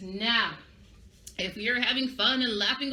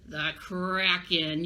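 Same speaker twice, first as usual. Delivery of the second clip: ominous reveal; 5 semitones lower, much slower than usual. Shown as a demonstration. Dramatic naming of scary monster.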